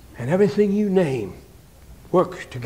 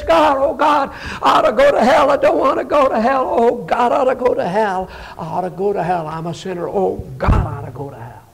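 pleading sinner. Acted. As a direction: beg God, fading phrase by phrase. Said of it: "gets down on his knees and says".

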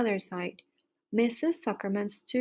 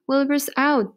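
higher, louder story voice; excited announcement; 4 semitones higher, 6 dB louder than usual. A narrator's reading. Horses spread news of Wilbur's escape, excited. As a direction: call out the news.

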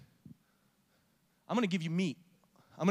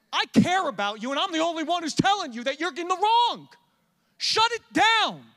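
high, loud impassioned appeal; full-force preaching; 9 semitones higher, 8 dB louder than usual. Acted. Pastor calling members to repent and come pray, impassioned preaching. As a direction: plead with them at full volume.

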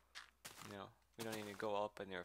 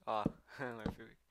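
normal talking voice

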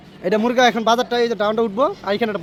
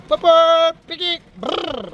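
imitated horn noises; 6 semitones higher, much slower than usual. Playful honking noises on the drive.

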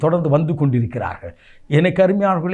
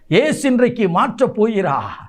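higher, proclaiming repeat; raised proclamation; 5 semitones higher than usual. Real, not acted. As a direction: lift the promise to the congregation. Promise repeated with more force.

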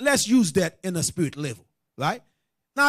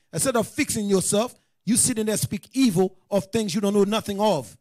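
ordinary talk, nothing marked

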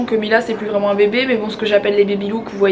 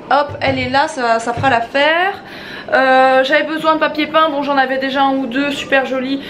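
ordinary delivery

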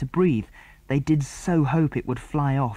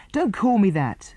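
high, louder hurt protest; hurt, near tears; 10 semitones higher, 5 dB louder than usual. A narrator's reading. Tom to Jill, high hurt protest. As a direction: push back, close to crying. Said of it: "looking almost ready to cry".

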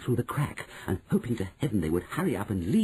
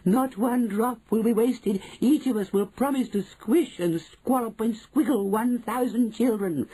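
high, excited witch chorus voice; eager witches' pledge; 13 semitones higher, 4 dB louder than usual. Acted. Witches promise to squish children, high eager chant.

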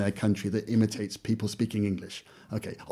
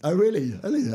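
surprised; 9 semitones higher than usual. Real, not acted. Surprised reply to interviewer.

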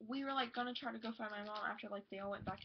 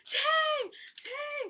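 retold shriek of his name, much higher and louder; calling out; 14 semitones higher, 11 dB louder than usual. Fan calling out to the singer, high excited shout. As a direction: scream his name to get his attention.

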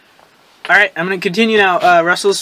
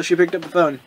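usual speaking voice

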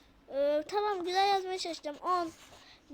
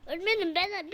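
ordinary delivery

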